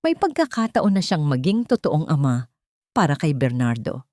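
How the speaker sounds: ordinary talk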